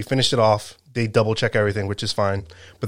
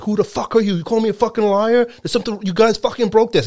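furious yelling; 11 semitones higher than usual. Retold or imitated. Store owner screaming in the driver's face, furious. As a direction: scream it at him nose to nose. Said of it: "fucking yelling at the driver".

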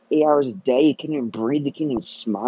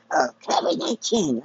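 ordinary talk

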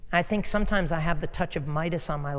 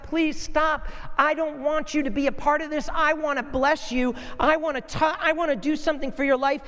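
God begging, pitched much higher; pleading God; 7 semitones higher than usual. Retold or imitated. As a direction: plead with them to stop, high up. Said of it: "God's like".

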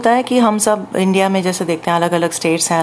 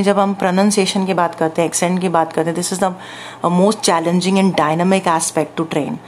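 ordinary talk, nothing marked

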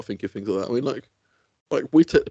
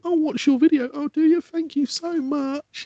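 high-pitched, gushing mock politeness; overdone friendly event chat, slowing on the thanks; put-on friendliness; slower than usual. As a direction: act thrilled with every single stranger. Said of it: "being friendly all weekend to every".

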